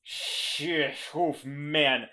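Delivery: ordinary talk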